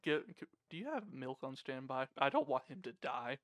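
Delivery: low, hushed aside; secretive murmur to the innkeeper; whispering aside. Acted. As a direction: lean in and keep it soft and confidential. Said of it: "leans forward and whispers to him".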